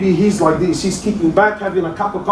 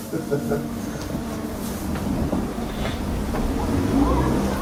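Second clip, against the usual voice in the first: breathy mocking laugh; 5 semitones lower, 10 dB quieter than usual. Mocking the careless.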